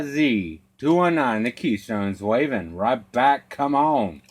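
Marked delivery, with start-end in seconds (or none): none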